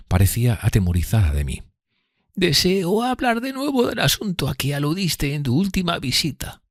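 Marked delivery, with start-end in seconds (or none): 2.4-6.6 high-pitched character voice, timid and earnest; fearful Miss Havisham, earnest. Acted. Miss Havisham confiding in Pip, high fearful voice. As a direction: voice her high and thin above the narration.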